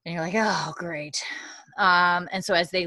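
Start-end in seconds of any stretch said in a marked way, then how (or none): 0.4-1.2 under-the-breath sarcastic whisper; muttered aside. Retold or imitated. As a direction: whisper it, deflated. Sarcastic aside at bad news.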